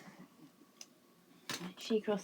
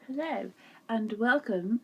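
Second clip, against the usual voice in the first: slow, grand show-host opening; mock show host intro; 3 semitones higher, slower than usual. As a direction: announce it like a TV craft show, playfully.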